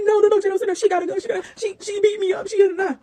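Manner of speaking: imitating the neighbor crying to her friend at the door; hysterical crying scream. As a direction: wail it out in mock hysterics.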